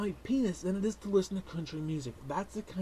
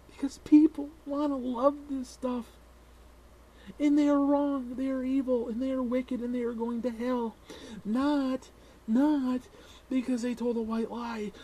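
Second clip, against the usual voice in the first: sarcastic preaching; 7 semitones higher, 5 dB louder than usual. Acted. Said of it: "I'm being a little sarcastic".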